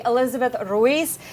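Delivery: ordinary talk, nothing marked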